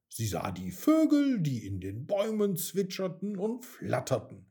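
usual speaking voice

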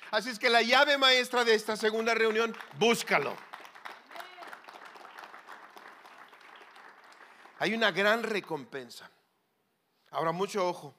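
loud emphatic sermon voice; preaching at full force. Acted. Preacher urging the congregation, loud emphatic preaching. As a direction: hammer home the key message.

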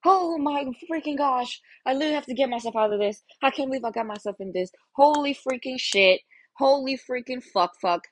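high, fast, frantic self-panic; panicked freakout. Retold or imitated. Herself freaking out after getting into a mess, panicked. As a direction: spiral into a panic with the words tumbling out. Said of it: "I would just be like".

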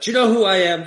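self-important, demanding outburst; indignant. Pretend. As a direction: puff up and demand respect. Confronting the construction crew.

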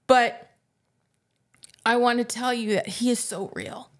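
ordinary talk, nothing marked

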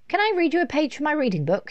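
put-on high, quick little girl's request; eager child. A narrator's reading. Laura asks Mum to hear her read, high and eager. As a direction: rush the request out high and loud. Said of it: "she asked".

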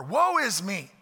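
preacher voicing Isaiah's anguished cry. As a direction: cry out in despair. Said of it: "He, he falls apart".

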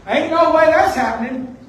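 loud, indignant Peter voice; playing Peter flatly refusing God. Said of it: "And Peter tells God".

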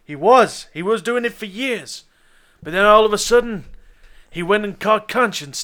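heated, emphatic account; insistent retort. Acted. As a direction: press the point against his denial. Woman insists against detective's denial.

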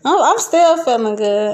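usual speaking voice

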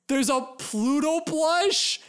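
can't believe your luck; delighted surprise. Real, not acted. Discovers Pluto plush.

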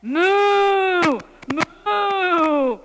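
long, loud, high moo; cow moo. A narrator's reading. Cow answers the kitten with long, loud moos. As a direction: let out big drawn-out cow moos. Reader voices the cow's moo.

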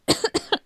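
sudden cough from choking; coughing after choking on a drink. Real, not acted. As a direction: cough it out, choked on a sip. (Husten nach dem Verschlucken)

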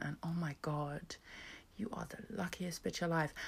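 a smug whisper; whispered mock vanity. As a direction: purr the self-praise in a whisper. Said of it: "I'm like".

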